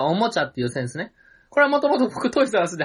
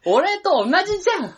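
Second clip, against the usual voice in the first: high, drawn-out excited claim; excited outburst; 10 semitones higher than usual. Pretend. Comic insisting to his partner they are alike, excited outburst.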